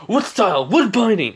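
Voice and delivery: high, loud technique shout; attack call. Acted. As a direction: call out the move high and forcefully. Acted jutsu call.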